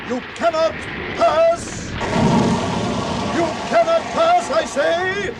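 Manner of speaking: a ringing shout that rises high on pass; shouted command. Acted. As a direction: bar the way with full voice. Wizard defies Balrog on bridge.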